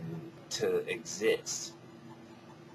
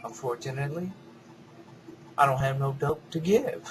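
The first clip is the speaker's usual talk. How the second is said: normal talking voice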